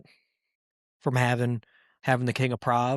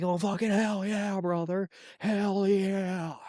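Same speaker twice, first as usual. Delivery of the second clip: hyped umps cheering; 7 semitones higher, 3 dB quieter than usual. Pretend. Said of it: "other umps are gonna be like".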